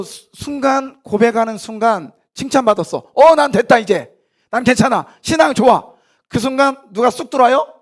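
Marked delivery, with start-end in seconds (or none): 3.1-5.9 loud, high self-satisfied crowing; smug boast. Acted. Preacher mimics a proud believer crowing loudly about his faith. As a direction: brag that you've made it.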